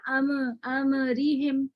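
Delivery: slow अमरीहिम with the मीम opened up; slow wrong take. Shown as a demonstration. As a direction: draw out अम so the मीम moves. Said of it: "तबाउद भी हो गया ना".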